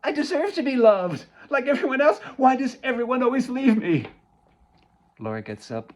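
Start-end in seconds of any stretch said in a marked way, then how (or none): 0.0-4.1 loud, high, sobbing pleas; crying loudly. Acted. Mother sobbing to her daughter about being left alone. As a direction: wail it through tears. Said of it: "Sophia's crying loudly now".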